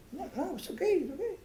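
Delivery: high, soft little voice; soft high-pitched mock reassurance; placating passenger. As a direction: back off meekly in a high voice.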